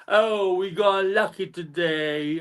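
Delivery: slow, lightly voiced Van Dijk impression; mock Van Dijk. Mocking the Liverpool captain's interview, slow. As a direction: drawl it out like a smug footballer. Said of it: "this is how he talks".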